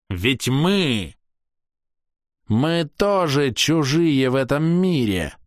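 high, proud ringing voice; a scornful elf lecturing strangers; haughty elf. A narrator's reading. Castiel continues.

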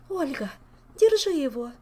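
urgent loud cry for help; shouting. Acted. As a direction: yell it out in alarm. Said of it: "закричала Азель".

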